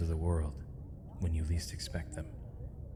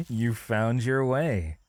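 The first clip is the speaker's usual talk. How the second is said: laugh, then higher, slow welcome; delighted chuckle; 6 semitones higher, 3 dB louder, slower than usual. Acted. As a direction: chuckle into a drawn-out, pleased greeting. Reunion after Leon follows the trail.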